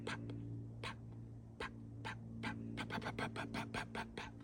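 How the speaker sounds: soft quick popped paps; staccato pap notes. Shown as a demonstration. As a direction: stack the paps in staccato rhythm. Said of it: "in a staccato rhythm".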